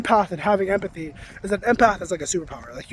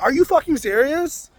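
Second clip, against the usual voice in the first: higher-pitched, mildly exasperated challenge; retold fury; 7 semitones higher than usual. Retelling an argument with a silent partner, mock-infuriated. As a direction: re-enact indignant disbelief, pitch lifted. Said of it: "I'm getting infuriated".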